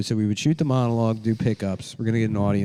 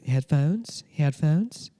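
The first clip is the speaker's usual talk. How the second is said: flight attendant offer; 7 semitones higher, much slower than usual. Retold or imitated. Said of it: "They go".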